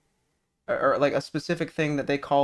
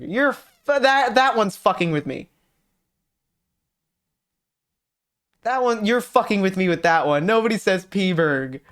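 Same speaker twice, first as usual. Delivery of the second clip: incredulous outburst; 5 semitones higher, 4 dB louder than usual. Real, not acted. Disbelief at the nickname P-burg.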